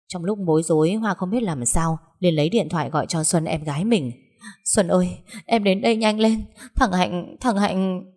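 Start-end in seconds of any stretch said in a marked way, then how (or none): 4.6-8.0 sobbing. Acted. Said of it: "vừa nói vừa khóc nấc lên".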